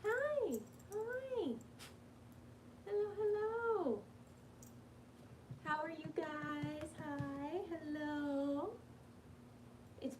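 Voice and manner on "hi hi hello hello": pet coo. Real, not acted. Cooing to pet.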